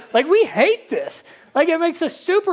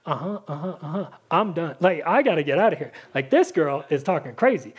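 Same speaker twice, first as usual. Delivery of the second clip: low, flat uh-huhs then hurried exit; a fiancé backing away from crazy talk; sceptical brush-off; 9 semitones lower than usual. Pretend. Joseph doubting Mary.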